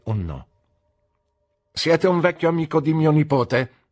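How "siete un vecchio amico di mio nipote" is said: higher and louder, forthright; brisk aunt. A narrator's reading. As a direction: speak up firmly to the guest. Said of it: "disse mia zia". (la zia, decisa e a voce alta)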